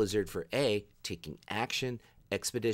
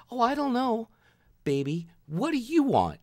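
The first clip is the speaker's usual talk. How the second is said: high, louder mock-sweet girlfriend; dithering girlfriend; 13 semitones higher, 6 dB louder than usual. Imitating the girlfriend stalling at the register, high cooing voice. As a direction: play the girlfriend cooing and undecided, pitched way up.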